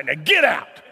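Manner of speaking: mock order. Acting out throwing the guest out.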